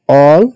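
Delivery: "all" said loud and drawn out as a memory cue; stress on all. Shown as a demonstration. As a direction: punch "all" so students remember it. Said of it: "মনে রাখবা এইভাবে". ("all" জোরে টেনে বলা)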